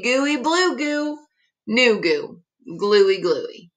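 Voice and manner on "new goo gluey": ordinary delivery, nothing marked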